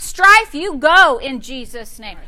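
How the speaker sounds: loud, commanding rebuke; feisty authority. Shown as a demonstration. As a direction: order it gone with force. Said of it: "take your authority".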